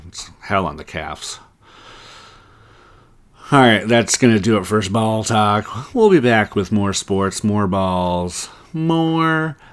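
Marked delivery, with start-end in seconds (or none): 3.5-8.5 upbeat podcast outro; show sign-off. Acted. 8.7-9.6 squeaky high teasing "more"; high-pitched tease. Acted. Suggestive pause before innuendos.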